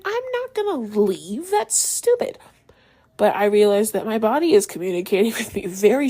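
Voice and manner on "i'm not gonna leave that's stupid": dismissive. Retold or imitated. Dismissing own urge to leave.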